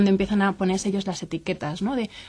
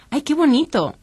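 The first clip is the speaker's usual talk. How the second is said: high, bright gush of praise over a drawing; delighted praise; 6 semitones higher, 4 dB louder than usual. Retold or imitated. Parent admiring a four-year-old's drawing, delighted gush. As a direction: gush over the kid's picture.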